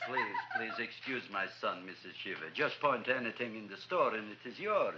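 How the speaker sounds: low, soft, soothing apology; smooth over an offended customer; hushed, low deference; placating apology. Acted.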